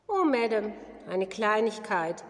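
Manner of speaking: plain reading voice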